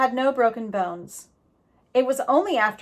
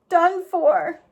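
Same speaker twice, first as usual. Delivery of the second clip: high, loud, despairing; terrified echo; 7 semitones higher, 5 dB louder than usual. A narrator's reading. Jack frozen before the bear, scared. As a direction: repeat it in dread.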